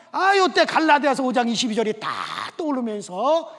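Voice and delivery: loud, high sermon climax; fiery preaching. Acted. The pastor pressing his congregation, preaching loud and high. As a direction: drive the point home to the congregation.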